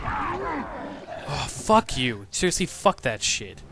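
loud angry curse; angry cursing. Real, not acted. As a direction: spit out the curse loudly. Died again, cursing.

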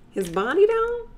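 playful high ogling tone; high mock-sultry. Shown as a demonstration. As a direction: stretch body up high, flirty.

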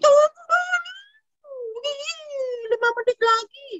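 put-on high-pitched child's protest at being woken; acted high squeal of a sleepy kid; snapping at parents. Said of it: "Ada yang bentak-bentak papa mama?".

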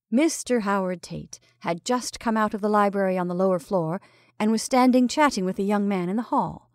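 ordinary delivery

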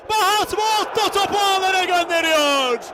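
screaming football commentator. Real, not acted. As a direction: belt the name out high. Goal call in live commentary. (bağıran futbol spikeri)

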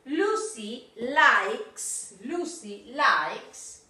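Lucy likes, very slow, stress on likes; teacher slowly models the verb with S; slow drill. Shown as a demonstration. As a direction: spell it out slowly for pupils. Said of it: "teacher drills the phrase".

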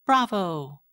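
high, loud bright "Bravo!"; delighted cheer. Acted. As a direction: cheer like a thrilled girl. Girl cheers after the play.

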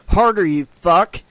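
loud, snarling curse; snarled command. A narrator's reading. Noon at Seaver mid-tryst, a loud snarl. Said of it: "she snarled".

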